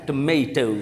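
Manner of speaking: टोमेटो the American way, "tuh-MAY-toh"; American "may" in the middle of टोमेटो; American pronunciation. Shown as a demonstration. Teacher shows how American people pronounce it, American "tuh-MAY-toh". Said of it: "American people इसी word को यूँ pronounce करते हैं".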